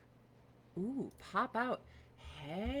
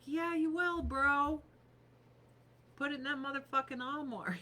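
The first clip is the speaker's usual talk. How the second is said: high sassy comeback, quick at the end; sassy retort; 5 semitones higher than usual. Retold or imitated. Answering husband about the armoire, sassy high retort. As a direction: sass him like you're winning. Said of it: "I'm like".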